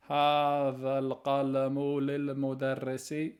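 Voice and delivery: careful Arabic sentence, "للمدرس" clearly voweled; model Arabic answer. Shown as a demonstration. Teacher reading the example answer aloud, careful. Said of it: "لمن هذا القلم".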